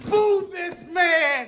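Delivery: rally the room to boo him; boo this man. Real, not acted. Streamer calling viewers to boo the man, shouting.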